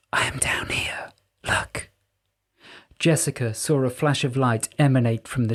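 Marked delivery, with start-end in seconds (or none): 0.1-1.9 a softer, unseen spirit's whisper; whispering Pyrope. A narrator's reading. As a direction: breathe each word like a hidden voice.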